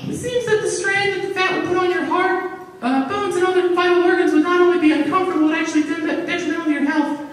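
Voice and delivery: a tiny know-it-all reciting a lecture; precocious granddaughter. Pretend. Granddaughter lecturing grandpa on his health, high childlike voice.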